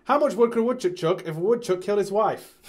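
rapid, shouted high-energy announcer; quiz show host. Acted. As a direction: fire it out at full blast. Plays rapid-fire quiz host.

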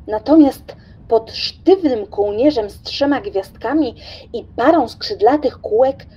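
usual speaking voice

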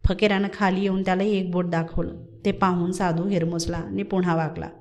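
ordinary delivery, nothing marked

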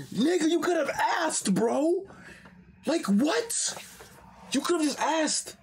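high, whiny mock protest; mock exasperated whine. Mocking Arthur's outrage at the lord, in a high whine. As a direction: whine like an offended kid.

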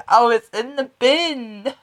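high, slow words through real laughter; giggling out the answer; laughing. Real, not acted. Teasing, laughing while telling.

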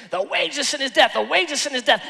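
lightly mimicked rapid-fire preacher; imitated pastor preaching. Pastor rushing to the altar call, lightly imitated preaching. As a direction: rattle it off like a pastor. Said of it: "preached it, preached it, preached it".

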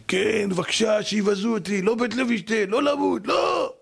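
high plea climbing to a wail; crying out pleading. Acted. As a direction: beg for your life, pitch rising to the end. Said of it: "איך הוא היה צועק לאליהו הנביא".

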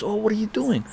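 high, excited retold small talk; eager question. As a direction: act out a keen mentor catching up. Mentor's eager question in reunion.

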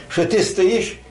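normal talking voice

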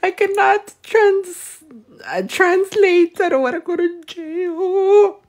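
high, slow words squeezed out through laughter; helpless high-pitched giggly wail; laughing squeal. Real, not acted. Refusing to translate for the comments, high laughing squeal.

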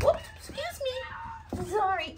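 high squeaky voice for the puppy; puppy's voice. Retold or imitated. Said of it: "You're like".

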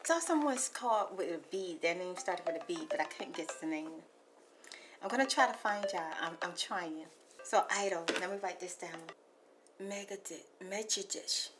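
soft hushed ASMR messages. Acted. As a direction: talk gently like ASMR to a caller. Soft ASMR voicemail talk.

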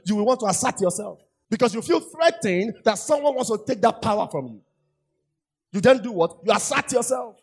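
full-voiced pulpit exhortation; preaching at full force. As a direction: preach it out high and loud to the congregation. Preaching on letting go of control.

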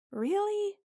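sharp high disbelieving really; can't believe the shrug; high incredulous retort. Acted.

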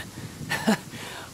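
a quiet, hushed little laugh; soft fond chuckle. Acted. Big brother to bargaining little boy, a soft fond chuckle. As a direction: laugh softly, charmed.

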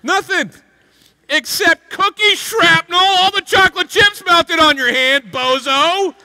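hammed-up mom busting the kid; exasperated scolding. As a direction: rub it in like a fed-up mom.

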